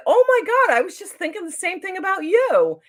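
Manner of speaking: high, loud retold gush; excited recognition. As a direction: re-enact thrilled agreement with a new acquaintance.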